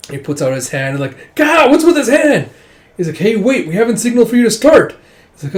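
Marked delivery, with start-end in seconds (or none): 1.3-2.5 much higher, loud startled cry; shocked yelp. Acted. Shocked reaction to the dragon's hand. 3.2-5.0 higher, louder and fast; flustered protest. Acted. Referee protesting the early start.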